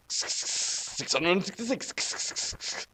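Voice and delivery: very slow, drawn-out spooky whisper; slow creepy whisper; eerie whisper. Acted. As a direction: breathe the number like a dark omen. Ominous chapter number reading.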